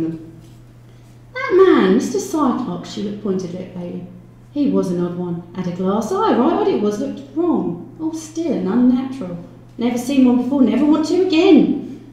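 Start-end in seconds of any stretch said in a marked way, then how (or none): 1.3-2.9 high, loud landlady voice; emphatic landlady. Acted. Landlady naming the odd lodger to police, high and loud. 4.5-12.0 landlady voice rising higher and louder; disturbed landlady. Acted. Landlady describing the lodger's glass eye, increasingly high and emphatic.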